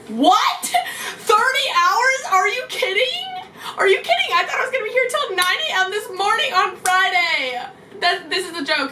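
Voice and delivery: mock-horrified high yelp; shocked disbelief. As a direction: squeal out the disbelief. Shocked at thirty hour wait.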